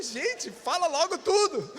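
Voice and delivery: mock exasperated child, pitched high; slow, high-pitched comic plea to hurry up. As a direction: playfully beg in exasperation. Child's plea during syllable beating.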